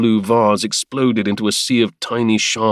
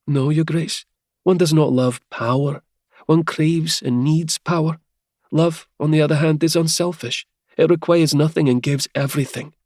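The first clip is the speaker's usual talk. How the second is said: higher, lightly voiced young woman, confident and steady; Helia, bold young lady; 7 semitones higher than usual. Helia defies the Duke in a higher, confident woman's voice. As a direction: lift into a higher woman's voice, bold but calm.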